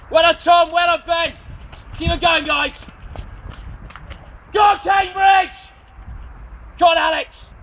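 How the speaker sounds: loud, high shouts of encouragement; urging runners on from the course; cheering shout. Real, not acted. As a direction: bellow support at passing runners.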